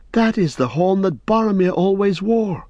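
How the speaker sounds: high, urgent hobbit outburst; a young hobbit crying out in recognition; cried out. Acted. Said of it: "cried Pippin".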